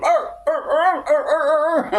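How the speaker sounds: very high, wailing mock groans; mocking imitated moans.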